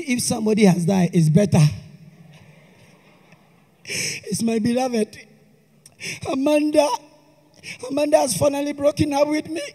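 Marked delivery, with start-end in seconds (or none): 3.8-9.6 heartbroken. Acted. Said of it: "I didn't see you cry like this".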